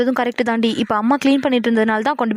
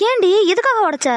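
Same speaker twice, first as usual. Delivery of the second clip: high-pitched, incredulous question; shocked questioning; 7 semitones higher than usual. Acted. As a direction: demand a reason in shocked disbelief. Sister shocked at the confession. (அதிர்ச்சியோடு கேட்பது)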